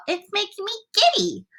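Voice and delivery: high-pitched complaint; calling out. A narrator's reading. Miss Spider complains of the height, calling out high. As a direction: plead in a high voice. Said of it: "Miss Spider called".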